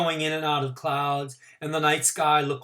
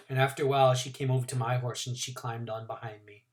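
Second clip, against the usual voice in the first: usual speaking voice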